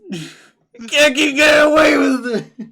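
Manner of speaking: high, slow, shouted meme line. Acted. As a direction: yell it like the famous meme. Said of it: "it's the meme".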